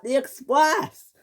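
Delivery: high, drawn-out call; stretched, high-pitched exclamation; screamed reminder. Acted. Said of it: "screams Top Hat".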